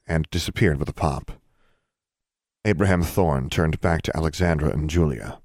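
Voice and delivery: usual speaking voice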